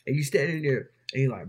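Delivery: ordinary talk, nothing marked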